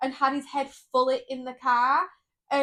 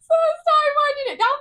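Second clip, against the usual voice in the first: laughing; 7 dB louder than usual. Real, not acted. Still laughing after story.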